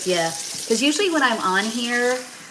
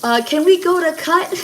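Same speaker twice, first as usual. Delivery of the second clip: flustered plea; 9 semitones higher, 5 dB louder than usual. Retold or imitated. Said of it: "And I was like".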